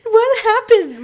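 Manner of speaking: high, loud, incredulous question; loud disbelief. Real, not acted. Friend amazed by the haunted house story, loud high disbelief. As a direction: demand to know, voice leaping up high.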